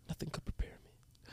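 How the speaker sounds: dramatic whispered line. Shown as a demonstration. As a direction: breathe it like a trailer whisper. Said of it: "That was good".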